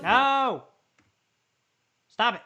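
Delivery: a real loud no, then a firm stop; loud protest. Real, not acted. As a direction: cut them off, fed up. Reacting in annoyance to the clip.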